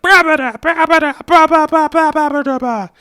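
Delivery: high, loud squeaky minion scream; screaming minion babble. Acted. Hopper screaming at the back, loud squeaky minion babble. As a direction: shriek the babble like a panicked minion. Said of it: "Hopper screaming at the back".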